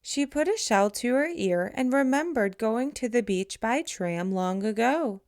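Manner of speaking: usual speaking voice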